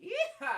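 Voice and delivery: high cowboy whoop; high yeehaw whoop. Real, not acted. Streamer racking shotgun for chat, cowboy whoop.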